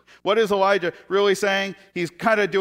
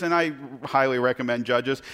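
usual speaking voice

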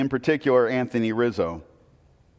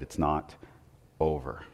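much softer and lower, nearly a murmur; hushed and low; 9 semitones lower, 10 dB quieter than usual. Retold or imitated. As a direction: lean in and say it low and quiet. Ross to the team at game seven.

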